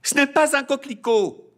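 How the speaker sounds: high loud indignant cry; loud high girl's protest; shouting girl. A narrator's reading. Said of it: "cria Magnolia".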